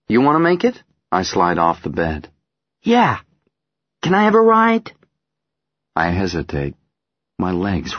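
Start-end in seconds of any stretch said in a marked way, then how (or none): 0.1-0.8 playful. Acted. Father playful. 2.8-4.9 put-on high, loud eager child; excited little girl.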